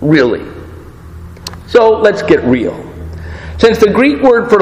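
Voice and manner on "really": "Really?" dropped low and falling, earnest; a firm low "Really?" that means it; serious. Shown as a demonstration. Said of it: "if the other one is serious".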